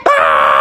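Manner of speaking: long acted scream of terror; drawn-out cartoon scream; hammed-up scream. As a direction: scream as if still falling. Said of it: "All Froggy could do was scream".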